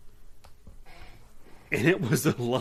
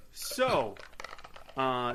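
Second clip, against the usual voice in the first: usual speaking voice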